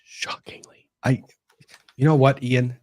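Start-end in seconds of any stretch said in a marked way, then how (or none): 0.0-0.9 unvoiced whisper of mock disbelief; whispered aside. Real, not acted. Whispering to co-host about the dog. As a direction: breathe it like a secret aside.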